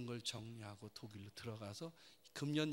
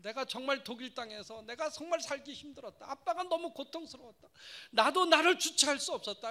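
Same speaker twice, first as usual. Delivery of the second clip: put-on raised, higher outcry of old pain; crying out; 12 semitones higher, 12 dB louder than usual. Father crying out his hardship to his daughter. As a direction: pour out the hurt loudly.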